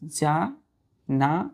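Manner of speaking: जानाडू drawn out slowly, each syllable given full length; careful pronunciation. Shown as a demonstration. Teacher asks students to pay attention, then says जानाडू very slowly. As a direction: stretch जानाडू out so children catch it. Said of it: "ध्यान दीजिएगा".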